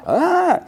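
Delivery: a sudden high yelp of embarrassment; flustered squeak. Retold or imitated. Jimmy, flustered mid-confession to Kara, lets out a high squeak. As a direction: squeak as if caught out mid-sentence.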